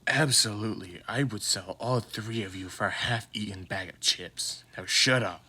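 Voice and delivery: lightly voiced weary father, flat and dry; deadpan tired dad. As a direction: grumble it like you can't be bothered. Deadpan father answers kids.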